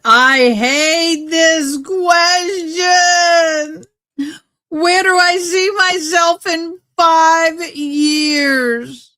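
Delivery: long drawn-out, high hammed-up wail; high mock-crying dread, every word stretched. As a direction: moan it slowly like a fake sob. Dreading a question, wailing it.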